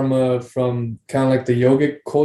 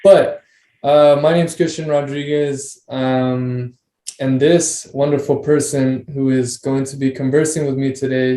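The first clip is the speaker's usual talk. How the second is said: ordinary talk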